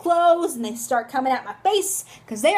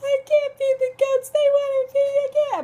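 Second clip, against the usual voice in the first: put-on high-pitched hysterical kid's scream; mock yelling; 12 semitones higher, 4 dB louder than usual. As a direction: shriek it like a panicked seven-year-old. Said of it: "Do you know what I was yelling?".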